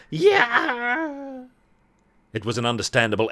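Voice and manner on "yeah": high piercing scream of pain. Acted. Xenia screams as the curtains open onto sunlight. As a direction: shriek as the sunlight burns.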